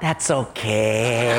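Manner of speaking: low, slow, embarrassed reply; comically meek, drawn-out acceptance; sheepish forgiveness. Retold or imitated. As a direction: let it go after being caught out.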